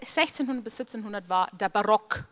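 usual speaking voice

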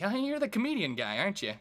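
high, loud put-on voice; mock high-pitched coach impression; Coach Pinkel. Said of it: "like, through Coach Pinkel. He's like".

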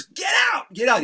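high-pitched angry yell, retold; furious ejection. Kirk throwing the band out, furious yell. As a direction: imitate an outraged man throwing people out.

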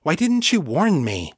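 Kate's voice, upset and accusing. Acted. Kate to John, upset and accusing.

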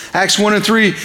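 usual speaking voice

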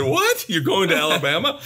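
family's high, disbelieving echo of the word Alabama; mock disbelief. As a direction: play the family's stunned surprise. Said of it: "And my family said".

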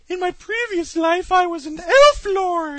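high, slow, airy mock ethereal singer. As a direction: float it up like a dreamy elf.

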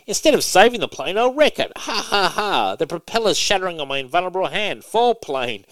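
high, loud hammed-up gloating villain; cartoon hero gone bad, cackling; villainous Superboy. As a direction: camp evil gloat with a fake laugh. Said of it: "he goes".

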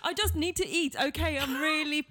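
hammed-up desperate yelling; mock screaming. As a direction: wail about being broke in mock despair. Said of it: "stop screaming and slamming your hand".

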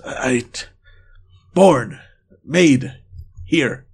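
single words with long pauses; halting broken words. Acted. Wild man straining to explain his origin, broken words. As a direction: push out one word at a time. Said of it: "to find the right words to say".

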